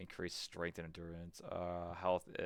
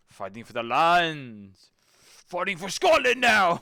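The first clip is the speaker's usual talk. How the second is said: loud, high hammed-up Scottish battle cry; a Scottish warrior rallying for his land, pitched up; Scottish accent; 9 semitones higher, 16 dB louder, slower than usual. Streamer voicing his knight fighting for Scotland, in a loud Scottish accent. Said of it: "I'm Scottish".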